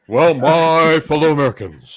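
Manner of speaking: loud, hammed-up presidential address; elder Bush. Comic impression for beer podcast friends, presidential voice. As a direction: open like a president addressing the nation. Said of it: "was the elder Bush".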